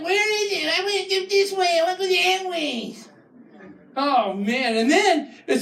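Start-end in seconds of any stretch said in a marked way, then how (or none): 0.0-3.0 whiny local. Retold or imitated.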